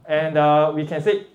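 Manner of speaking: ordinary delivery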